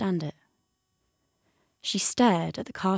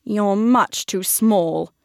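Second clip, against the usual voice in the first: louder, slow dismissive snap; cat snapping at the squirrel; 6 dB louder, much slower than usual. A narrator's reading. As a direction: put the little squirrel down, slowly. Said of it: "snapped the cat".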